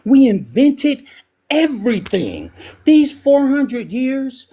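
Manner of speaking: getting worked up over the ancestors; impassioned rise, slowing at the end; emotional. Real, not acted. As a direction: voice climbing high with passion. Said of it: "I'm getting emotional".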